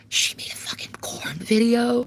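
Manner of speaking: whispered, slow and high; stage whisper. Real, not acted. Streamer gossiping to chat in a scandalized whisper. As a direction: hush it like a juicy secret.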